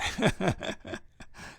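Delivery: high unvoiced chuckle; real breathy high laugh. Real, not acted. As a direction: laugh it off after your own rant. Amused after his France rant.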